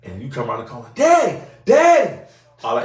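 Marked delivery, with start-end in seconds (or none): 0.9-2.2 put-on high, slow, loud child calling Daddy; little kid voice. The child running to the father, high excited kid voice. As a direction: squeal it like an excited toddler.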